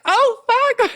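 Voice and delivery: very high shocked squeal, retold; high mock freak-out; stunned. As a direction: re-enact disbelief, pitch shooting up. Retold shock at movie news.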